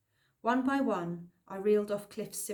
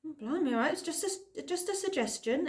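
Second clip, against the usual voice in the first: muttered backing down; 8 semitones higher than usual. A narrator's reading. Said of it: "Ephraim muttered".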